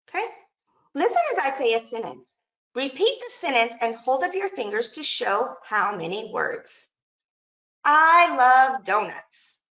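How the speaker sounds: teacher leading a class exercise; teacher hat. Shown as a demonstration. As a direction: give the sentence out clearly for children to repeat. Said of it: "back to my teacher hat".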